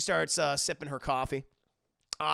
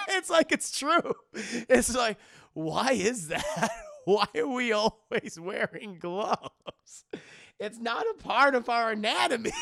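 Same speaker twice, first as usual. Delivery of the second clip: talking through real laughter; giggling, words slowed by laughs; laughing; 6 semitones higher, 5 dB louder, slower than usual. Real, not acted. Streamer cracking up at joke.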